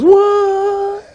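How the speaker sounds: very high, held exclamation of disbelief; amazed king. Acted. King amazed at gold again.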